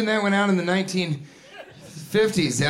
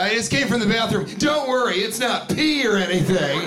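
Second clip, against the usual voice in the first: high, quick mock reassurance; awkward joker; 5 semitones higher than usual. Wet-handed greeter making a joke, high mock-jokey voice. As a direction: imitate someone pushing a feeble joke brightly. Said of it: "feel like they have to make a joke".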